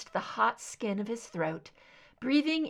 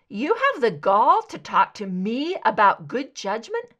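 raised, heated comeback; angry retort; 3 semitones higher, 7 dB louder than usual. Acted. Scully fires back at Mulder in anger, loud and heated. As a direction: snap back at him, louder.

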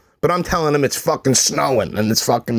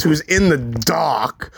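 usual speaking voice

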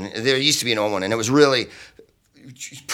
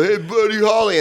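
much higher, louder jeer; dumb heckler; 10 semitones higher, 4 dB louder than usual. Retold or imitated. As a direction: call out a lame heckle.

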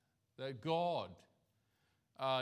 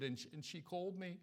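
soft, higher, tearful imitation of a heartbroken man; imitating sobbing; 4 semitones higher, 7 dB quieter, faster than usual. Heartbroken friend telling the pastor about his girlfriend, mock tearful. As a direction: lightly mimic a man choking up. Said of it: "he's sobbing a little bit".

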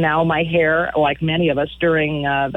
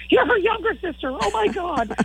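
high, squealing mock fangirl; young women at the bar gushing, high and squealy; squealing young fan. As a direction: squeal it like a starstruck twenty-something. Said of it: "they're like".

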